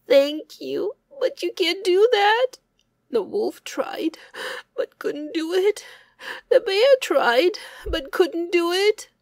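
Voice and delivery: high, loud, tearful refusal; despairing hare. Acted. Hare telling bear it's no use, high and tearful. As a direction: plead it hopelessly.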